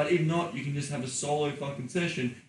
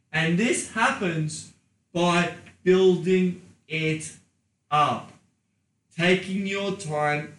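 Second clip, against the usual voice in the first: very slow, louder on building; slow emphasis; 4 semitones higher, 6 dB louder, much slower than usual. Acted. Coaching listeners to take their time, slow and emphatic. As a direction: draw out building it up.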